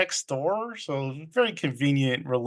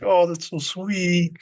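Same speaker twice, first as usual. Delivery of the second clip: mock sweet daughter; 3 semitones higher than usual. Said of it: "the daughter's like".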